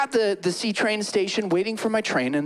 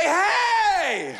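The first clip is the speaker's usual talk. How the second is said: excited shout; 10 semitones higher than usual. Retold or imitated. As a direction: yell a happy greeting. Retold call, shout heard.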